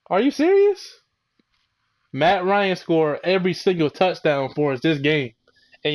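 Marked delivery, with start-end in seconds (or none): 0.1-1.0 incredulous. Real, not acted. Incredulous outburst at fans.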